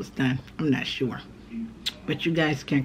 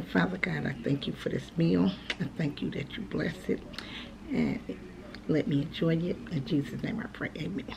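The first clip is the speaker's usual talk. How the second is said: prayer; 6 dB quieter than usual. Real, not acted. Says grace before the meal.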